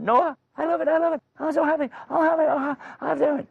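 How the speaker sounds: very high, gushing wife voice; put-on high-pitched babble of delight; ecstatic pleading. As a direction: plead in a joyful squeal. Acted wife pleading for baby.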